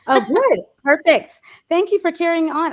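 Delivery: high, loud and relieved; excited relief. Real, not acted. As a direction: gush with relief. Relieved host after dropout.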